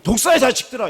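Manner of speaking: loud, high rebuke on 독사의; God rebuking, loud and high. Retold or imitated. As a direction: hurl it out sternly. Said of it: "오늘 하나님이".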